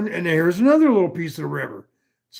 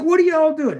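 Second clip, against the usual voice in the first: much higher, stunned protest; incredulous; 11 semitones higher, 3 dB louder than usual. Retold or imitated. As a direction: throw it up high like you can't believe it. Confused at paramedics grabbing him.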